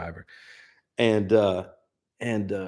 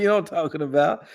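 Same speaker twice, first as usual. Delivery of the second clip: ordinary delivery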